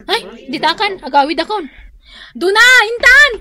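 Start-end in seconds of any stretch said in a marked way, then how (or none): 2.3-3.3 calling out. Acted. Calling out to leave.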